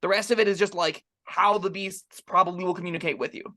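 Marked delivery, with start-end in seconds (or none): none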